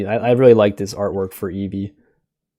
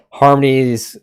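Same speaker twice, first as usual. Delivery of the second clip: ordinary talk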